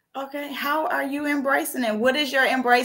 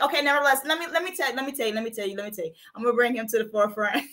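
usual speaking voice

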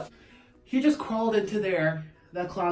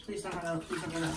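quiet pleading; 10 dB quieter, faster than usual. Real, not acted. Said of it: "begging cat quietly".

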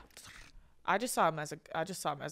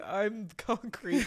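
very slow, drawn-out words; mock blissed-out drone; much slower than usual. Tripper on the balcony becoming concrete, slow blissed-out imitation. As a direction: stretch it out as if in a trance. Retold blissed-out trance while friend suffers.

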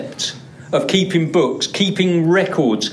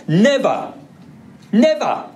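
Never punched out, the second one much higher; preacher stressing that names are never erased; emphatic never; 6 semitones higher than usual. Shown as a demonstration. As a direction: hit Never hard and let it fall. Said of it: "It can't be any stronger".

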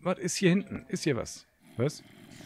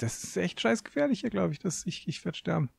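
scared; 10 semitones higher than usual. Real, not acted. Scared in a dangerous cave.

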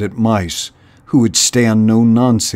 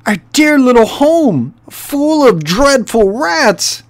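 put-on much higher, fluttering old-lady voice; fretful old mouse; 14 semitones higher, 5 dB louder than usual. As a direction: wail over the lost home. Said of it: "Missus Churchmouth began sobbing".

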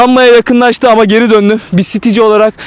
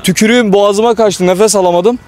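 normal talking voice

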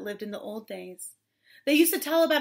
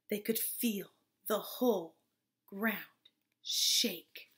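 soft, unvoiced storyteller whisper; hushed suspense; 5 semitones lower, 7 dB quieter, slower than usual. A narrator's reading. Storyteller as the giant's steps shake ground, hushed whisper.

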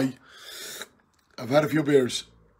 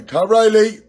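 raised call of the name, higher pitch; 6 semitones higher, 5 dB louder, slower than usual. Real, not acted. Calling out to old pal Tom for the challenge, loud.

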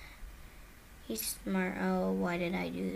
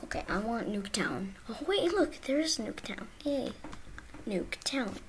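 ordinary talk

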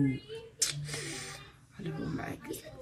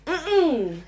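rising whine; 9 semitones higher, much slower than usual. Acted. Repeated refusal rising higher.

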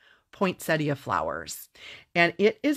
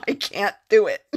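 laughing admission, higher and louder; 5 semitones higher, 5 dB louder than usual. Real, not acted. Crafter admitting defeat to viewers, laughing. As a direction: break into laughter on the words.

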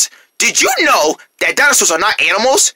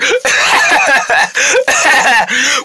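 giggly laugh; 3 semitones higher than usual. Acted. Laughing after roar.

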